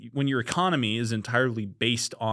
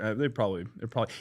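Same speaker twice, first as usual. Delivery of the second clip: ordinary delivery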